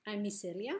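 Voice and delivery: ordinary delivery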